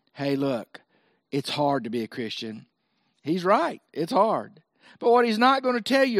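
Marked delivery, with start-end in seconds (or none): 0.1-2.7 low, soft confidential voice; acted quiet discouragement; discouraging aside. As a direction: drop low as if confiding bad news. Voicing Satan's discouraging talk.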